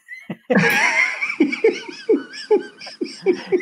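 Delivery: high, hearty burst of laughter; real hearty laugh; big laugh. Real, not acted. Laughing at his own dodge.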